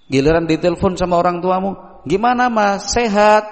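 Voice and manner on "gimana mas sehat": higher, eager mock parent; parent asking. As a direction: check on the son warmly. Parent phoning sick child.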